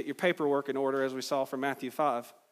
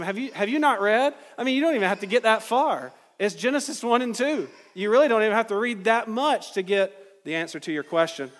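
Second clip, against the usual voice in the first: raised, pointed mock disbelief; sarcastic; 4 semitones higher, 4 dB louder than usual. Preacher voices Jesus chiding religious leaders, sarcastic. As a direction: chide experts for missing the obvious, pitched up. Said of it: "when I see Jesus being sarcastic".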